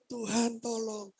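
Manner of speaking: high, soft, drawn-out whine; rengekan seperti bayi. Acted. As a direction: plead weakly like a baby, stretching it. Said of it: "Seperti bayi. Nangis". (rengekan pelan bernada tinggi seperti bayi)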